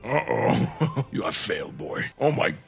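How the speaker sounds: hammed-up stern god addressing his son; Kratos voice. Holds Kratos voice.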